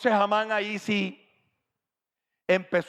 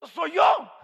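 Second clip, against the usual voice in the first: loud, high smug boast; shouted self-important claim; proud boast; 8 semitones higher, 5 dB louder than usual. Acted. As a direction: crow about yourself.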